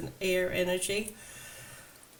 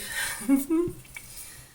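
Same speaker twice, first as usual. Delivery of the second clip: high laugh rising at the end; light real laugh; 11 semitones higher, 4 dB louder than usual. Real, not acted. Reader amused by celebration with your tribe, laughing. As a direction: laugh lightly, pitch climbing.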